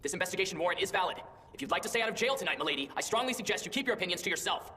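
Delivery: stern official warning. Acted. Official warns the noble lady.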